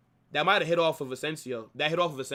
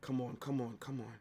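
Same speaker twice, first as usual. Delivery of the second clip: quiet pleading; 5 semitones lower, 14 dB quieter than usual. Real, not acted. Nervous fan pleading quietly.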